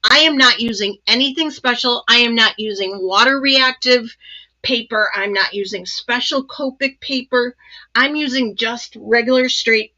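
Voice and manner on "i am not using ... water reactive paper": ordinary talk, nothing marked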